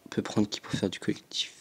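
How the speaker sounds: whispered to himself; thinking aloud in a whisper. Real, not acted. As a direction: breathe the question under the breath. Muttering to himself while choosing.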